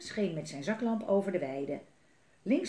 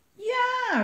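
high, loud, stretched-out yell; triumphant cheer; 8 dB louder than usual. A narrator's reading. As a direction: cheer like the whole gang won. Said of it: "riepen Melle, Limonie en Katja".

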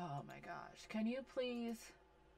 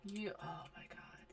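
whisper; 3 semitones lower, 9 dB quieter than usual. Real, not acted. Muttering at a sim.

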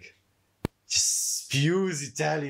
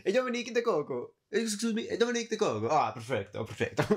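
much higher, hammed-up bad Italian accent; 8 semitones higher than usual. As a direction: ham up a clumsy Italian greeting. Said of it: "doesn't have the best accent".

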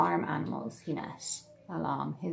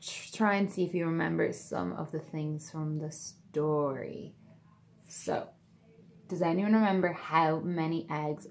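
ordinary delivery, nothing marked

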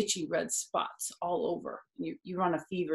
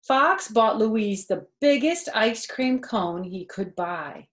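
lively storybook voice with character quotes; reading to children; 5 dB louder, slower than usual. A narrator's reading. Narrator reading a picture book to kids, lively storytelling. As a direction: read it aloud to a child, bright and animated.

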